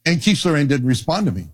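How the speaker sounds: ordinary talk, nothing marked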